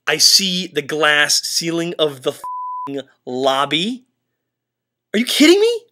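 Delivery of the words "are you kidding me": shrill high disbelieving cry, louder; freaked-out disbelief. Real, not acted. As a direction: yelp it in shock at the window. Freaked out by haunted room.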